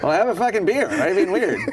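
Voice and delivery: high, loud needling; mock pushy cajoling. Imitating late friend urging him to stay, loud cajoling. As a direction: imitate a friend brushing off complaints.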